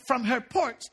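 normal talking voice

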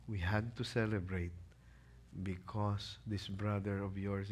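low, soft, tender reassurance fading to a whisper; calm the angry son softly; gentle father. Acted. Father soothing his resentful son, low and tender.

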